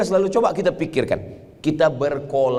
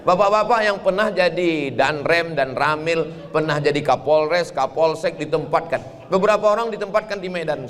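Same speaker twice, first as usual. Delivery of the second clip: normal talking voice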